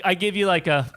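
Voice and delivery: usual speaking voice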